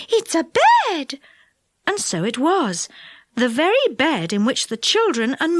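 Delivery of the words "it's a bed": high, slow astonished cry; wonder. A narrator's reading. Fairy realising it is a bed, high and amazed. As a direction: draw out the discovery in amazement. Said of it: "said Silky in wonder".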